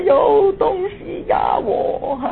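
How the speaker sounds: slow, high sobbing cry, fading out; high wailing sob that dies into a breath. Retold or imitated. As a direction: cry out in terror like a frightened child. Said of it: "我就立刻哭了".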